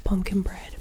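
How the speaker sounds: ordinary talk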